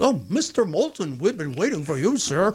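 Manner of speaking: lilting, swooping mock maître d' welcome; imitated tuxedoed clipboard man; fawning greeter. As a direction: greet a VIP like an obsequious host. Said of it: "this big guy in a tuxedo's got the clipboard".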